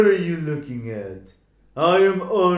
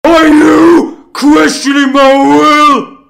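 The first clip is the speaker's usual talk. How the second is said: loud, much higher indignant demand; angry outburst; 10 semitones higher, 13 dB louder than usual. Acted. Giant loudly snaps at the Deathspawn for questioning him. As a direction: bellow at the insolent spawn.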